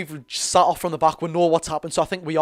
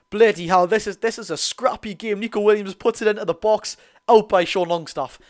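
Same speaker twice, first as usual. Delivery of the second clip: loud, higher exasperated match call; live play-by-play; 4 semitones higher, 4 dB louder than usual. Acted. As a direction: commentate a scrappy spell with exasperation.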